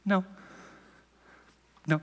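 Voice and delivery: calm, flat-out refusal; just say no, unbothered; understated no. Retold or imitated. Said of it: "awesome, understated way".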